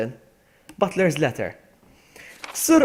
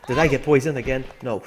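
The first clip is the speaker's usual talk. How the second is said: ordinary delivery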